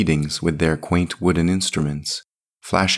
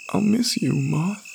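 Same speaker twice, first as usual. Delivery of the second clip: put-on very high child's voice; little boy's farewell; 13 semitones higher than usual. Boy says goodbye to Moth, high childlike voice.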